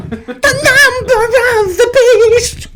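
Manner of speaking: very high, slow eerie wail; spooky voice. Pretend. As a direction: moan it like a ghost. Joke about sixty-six proof.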